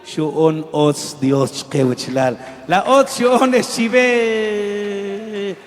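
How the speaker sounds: loud, slow preacher's cry; fiery preaching. Acted. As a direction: preach it to the whole church. Preaching at full force. (predicación a todo pulmón)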